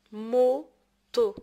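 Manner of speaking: "Moto" in two loud, separated beats; syllable by syllable. Shown as a demonstration. Teacher giving an example of O, syllable by syllable. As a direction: sound out "Moto" firmly, pausing between syllables. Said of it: "تقطع الكلمات كيتسمى سيلاب".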